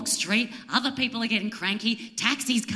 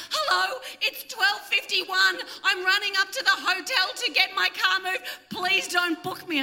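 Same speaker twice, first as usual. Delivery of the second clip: pleading note; 10 semitones higher than usual. Retold or imitated. Reading her note to parking inspector.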